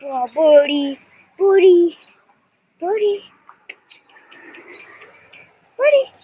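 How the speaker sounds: sing-song call climbing up; calling the bird. Real, not acted. Child coaxing baby bird on the porch, rising sing-song call.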